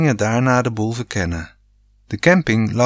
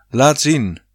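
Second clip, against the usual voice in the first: commanding; 3 semitones higher than usual. A narrator's reading. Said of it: "commandeerde pa".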